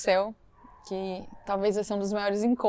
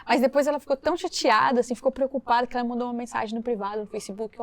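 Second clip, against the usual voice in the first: usual speaking voice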